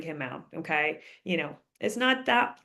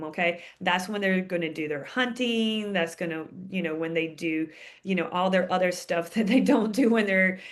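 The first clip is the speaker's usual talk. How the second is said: ordinary delivery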